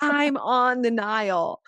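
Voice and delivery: drawn-out, insistent repeat into a poor phone line. Retold or imitated. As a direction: spell it out over a breaking-up connection. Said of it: "it's, like, breaking up".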